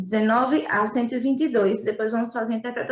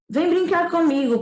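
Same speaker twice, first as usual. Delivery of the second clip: put-on higher childlike invitation; little prince; 5 semitones higher than usual. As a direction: invite the fox to play, higher. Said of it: "propôs o príncipezinho". (voz aguda de menino convidando)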